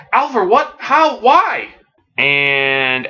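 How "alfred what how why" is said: high, bewildered questions; shocked disbelief. Acted. Robins learning Hush cured the butler, shocked disbelief. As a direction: fire off stunned questions, pitch way up. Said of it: "everyone's like".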